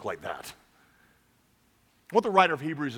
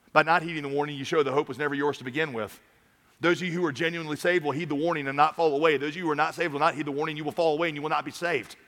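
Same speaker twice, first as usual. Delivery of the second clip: ordinary talk, nothing marked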